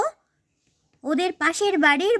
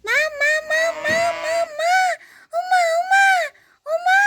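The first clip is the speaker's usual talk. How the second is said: put-on child crying for mother; 10 semitones higher than usual. Scared child screaming for her mother, crying. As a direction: wail for mom in panic, pitch way up.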